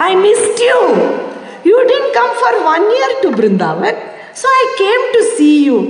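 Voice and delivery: high, tender voice of Swami; the Lord gently reassuring his devotee; affectionate Swami. Retold or imitated. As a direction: lift the pitch and speak lovingly.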